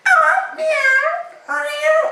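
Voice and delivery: very high squeaky kitten meow. Pretend. Boy mocks the captive man as a meowing kitten. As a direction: mew like a tiny cat. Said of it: "And he would be like".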